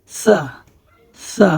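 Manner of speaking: সা as a light, lisped "tha"; tongue tip at upper front teeth for সা; very thin সা. Shown as a demonstration. Teacher models the Arabic letter, thin "th" in সা. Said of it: "একদম পাতলা, উচ্চারণটি হবে একদম পাতলা".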